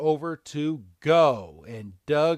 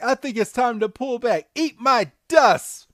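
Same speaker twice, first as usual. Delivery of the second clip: high, loud cartoon taunt, slowing at the end; Meowth; 10 semitones higher, 6 dB louder than usual. Podcaster voicing an escaping villain, high mock taunt. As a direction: toss a cocky parting taunt, pitched up.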